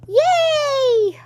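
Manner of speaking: high, loud drawn-out cheer; squealed celebratory whoop; excited cheer. Real, not acted. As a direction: cheer it out at full voice. Cheering for own video.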